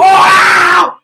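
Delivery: loud amazed wow; excited shout. Real, not acted. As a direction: shout it out in awe. Reacting to big play.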